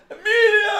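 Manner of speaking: loud high called-out name; mock shout. Calling out a film actor's name, loud high shout.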